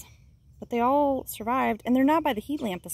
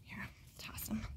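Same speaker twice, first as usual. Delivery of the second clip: whispering; 17 dB quieter than usual. Real, not acted. Whisper while disposing dead chick.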